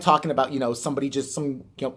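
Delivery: ordinary delivery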